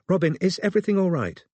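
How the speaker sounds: a lightly voiced anxious mum on the phone, quick; fretful mother checking in; mother's worried call. A narrator's reading. Mum asking her daughter on the phone, lightly anxious.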